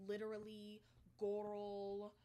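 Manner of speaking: loud mock Midwestern vowels; exaggerated Midwestern accent. Mocking the woman's fake accent, exaggerated Midwestern. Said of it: "an exaggerated Midwestern accent over it".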